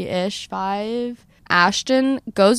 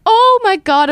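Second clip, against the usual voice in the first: much higher, louder mock panic; retold panic; 12 semitones higher, 6 dB louder than usual. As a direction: shriek it like the walls are closing in. Stuck in hotel dungeon, panicking.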